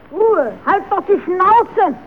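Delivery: loud, slow, cross yell for silence; angry shout. Acted. Puppet yelling at the noisy audience, loud and angry. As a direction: bark it out, fed up with the noise.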